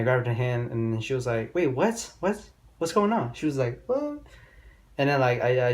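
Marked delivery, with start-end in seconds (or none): 1.5-3.3 put-on startled girl. Girl startled when hand grabbed. 3.9-4.2 high, squeaked disbelief; squeaky startled what. Retold or imitated. Girl at Spectrum reacts to her hand being grabbed, squeaky.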